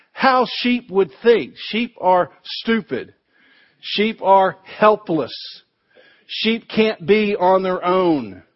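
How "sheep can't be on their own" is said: ordinary talk, nothing marked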